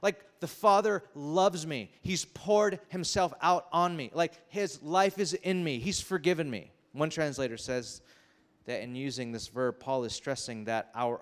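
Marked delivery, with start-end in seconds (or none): 0.0-6.7 slow, higher, swept up in joy; can't contain it, savour each word; ecstatic, drawn-out praise; overjoyed. Retold or imitated. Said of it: "You're like".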